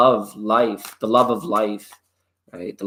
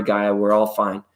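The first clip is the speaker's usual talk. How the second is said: ordinary talk, nothing marked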